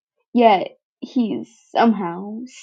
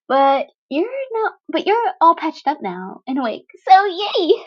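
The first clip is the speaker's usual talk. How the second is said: high, bright, giggly and loud; gleeful teen villain chirping at the wounded boy; bubbly villain girl Toga; 5 semitones higher, 5 dB louder than usual. Acted. Toga chirps to Izuku, high and gleeful.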